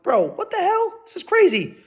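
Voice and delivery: mock-shocked high voice at the security check; amazed disbelief. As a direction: shriek it out like you can't believe it. Quoting himself amazed at security.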